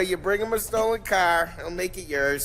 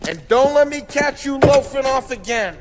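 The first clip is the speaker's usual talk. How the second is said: higher, louder parting jab; teasing shout; 9 semitones higher, 7 dB louder than usual. Acted. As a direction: holler it over your shoulder. Paulie shouts back at Ralphie.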